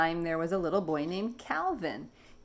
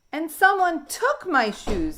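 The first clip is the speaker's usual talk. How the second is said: put-on higher, louder, slow baby voice; baby dinosaur; 10 semitones higher, 8 dB louder, slower than usual. Baby finding his shoes gone, upset. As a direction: whine it like a small upset child. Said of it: "said the baby".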